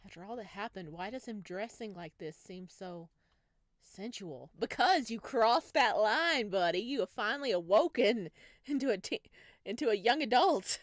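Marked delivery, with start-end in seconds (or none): 4.6-10.8 loud teasing. Real, not acted. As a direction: tease him loudly, then laugh. Hyping the game's romance scene.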